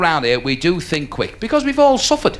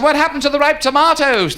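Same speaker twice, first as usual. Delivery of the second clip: high-pitched heckling question; high questioning call; 9 semitones higher than usual. Acted. Heckled seller is asked a question.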